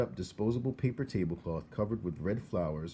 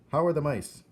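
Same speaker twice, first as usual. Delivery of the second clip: calling up; 8 semitones higher, 9 dB louder than usual. Acted. Coraline calling up.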